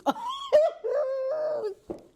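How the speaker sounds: bursts into a loud, high laugh; a real laugh breaking the reading. Real, not acted. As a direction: crack up out loud. Reader cracks up at scene.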